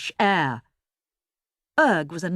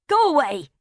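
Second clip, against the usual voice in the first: firm; 8 semitones higher, 4 dB louder than usual. A narrator's reading. Erg telling teddy off.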